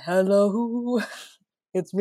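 a light take on [name] singing; velvety [name] [name]. Pretend. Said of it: "with the velvety".

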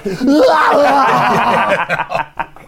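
long, loud, high hammed-up scream; mock scream. Fryer cook in a racing chip truck, mock scream. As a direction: scream out as if flung about, then laugh.

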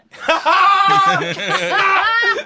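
shrieking laugh; loud high laugh. Real, not acted. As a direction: cackle loudly, high. Big laugh after own joke.

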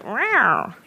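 drawn-out mock reaction; audience reaction demo. Shown as a demonstration. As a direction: pitch swooping up and down. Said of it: "you go".

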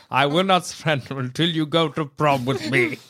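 rally the troops, but about prom; roaring rallying speech. Retold or imitated. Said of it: "a parody of Churchill's speech".

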